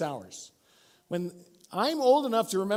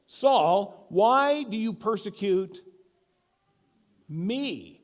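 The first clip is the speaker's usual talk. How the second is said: loud, slow, personal rebuke; ticked off; 2 semitones higher, 6 dB louder, much slower than usual. Retold or imitated. Jesus confronting Saul, slow and loud. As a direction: confront him, drawn out and hurt. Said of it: "I think Jesus is ticked".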